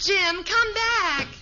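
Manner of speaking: exasperated call; calling after him. Acted. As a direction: stretch the words out to call him back. Wife calling after departing husband.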